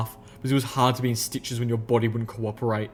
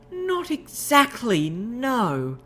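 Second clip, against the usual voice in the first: high, slow hesitant lady's voice; 12 semitones higher, much slower than usual. Acted. Rarity correcting Applejack, slow high hesitant voice. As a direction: drag it out, high and unsure.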